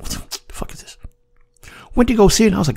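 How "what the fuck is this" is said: whispered, breathy what the fuck; barely-voiced skeptical aside; muttered disbelief. Recalling his reaction to his prepper friend's movie pick, whispered.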